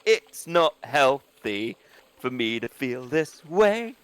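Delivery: drawn-out, halting cry for help; deliberate, word-by-word distress message; slow mock plea. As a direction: stretch each word out slowly, pleading.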